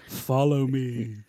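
very slow, hushed horror-movie voice; creepy. Acted. Replaying the come-on as a horror movie line, creepy and slow. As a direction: draw it out softly like a horror villain. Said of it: "it was creepy. It, it was like we were talking horror movies".

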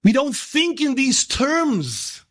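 slow, high preaching cry; sustained high-pitched sermon peak; impassioned exhortation. Real, not acted. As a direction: urge the congregation on, stretching every phrase.

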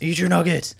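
loud, high mock yell; Ken Jeong. Friend echoing the film father's line, mock-aggressive shout. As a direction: bark it like a playful scolding. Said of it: "really aggressive".

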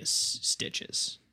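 breathy whisper on the last word; whispered punchline. Pretend.

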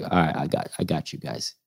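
quiet and low, calm the guys down; low murmur, I got this; Barry quietly reassures his friends at the club door, soft and low; low, soft reassurance. Retold or imitated.

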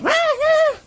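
high mock cries; mock shouting. Woman cries out as he does kaparot, high mock cries. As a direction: squeal high and short. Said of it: "she's continuing to shout".

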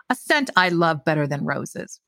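ordinary delivery

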